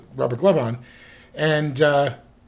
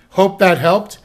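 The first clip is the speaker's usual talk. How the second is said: ordinary talk, nothing marked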